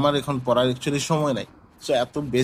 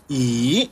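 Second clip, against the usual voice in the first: ই dipping, then rising; third tone. Shown as a demonstration. Teacher moving on to third tone, dipping-rising ই. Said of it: "third টোনে আসছি".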